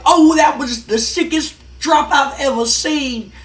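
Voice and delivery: high, slow amazed hype-man voice; Scotty Too Hotty gushing at a big move. Retold or imitated. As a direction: gush like a stunned tag partner. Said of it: "Scotty Too Hotty's just like".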